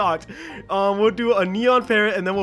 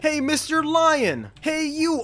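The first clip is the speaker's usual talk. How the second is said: higher, slow mock-haughty hail; calling out in space Karen role; 7 semitones higher, slower than usual. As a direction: call him over like an entitled alien. Space Karen calls at player.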